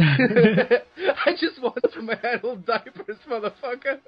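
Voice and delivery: high-pitched plea breaking up with laughter; helpless laughing imitation of a desperate man; laughing mock plea. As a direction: whine it high through your own laughter. Voicing paralyzed hero, laughing.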